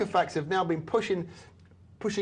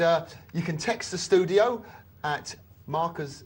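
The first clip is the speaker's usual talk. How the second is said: ordinary talk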